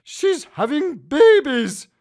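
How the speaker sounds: high, loud, slow shocked announcement; wife stunned by the discovery; astonished and drawn out; exclaiming. A narrator's reading. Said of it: "my wife exclaimed".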